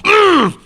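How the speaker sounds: loud, high strained "mm"; acted-out squeal of frustration; frustrated groan. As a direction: let out a pent-up high grunt. Acts out his frustrated reaction.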